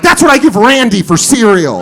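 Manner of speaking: loud, high blurted answer; brazen kid. Retold or imitated. Eight-year-old answers friend's dad, brazen and loud.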